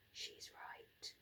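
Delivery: frightened whisper; timid boy whispering to a friend. A narrator's reading. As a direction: breathe it so nobody else hears. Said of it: "Norman whispered to Ella".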